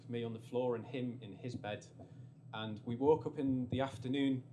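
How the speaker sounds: normal talking voice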